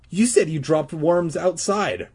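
high, angry accusation; accusing angrily. Acted. Regina accusing Todd of lying, angry and high. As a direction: call him out angrily. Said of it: "Regina accused angrily".

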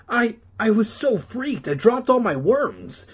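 high, worked-up stammering; crying out. Acted. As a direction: plead breathlessly like a freaked-out kid. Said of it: "Todd cried".

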